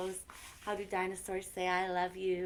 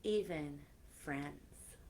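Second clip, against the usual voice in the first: gentle storybook reading with soft whispered lines; reading to children; 5 dB quieter than usual. Acted. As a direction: read it like a bedtime story to kids. Reading a picture book aloud.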